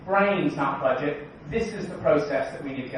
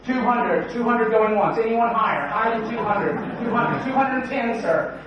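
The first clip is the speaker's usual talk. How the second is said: higher, louder auctioneer patter, taking bids; mock auctioneer; 6 semitones higher, 3 dB louder than usual. Speaker auctions off audience guesses, hammed-up auctioneer call.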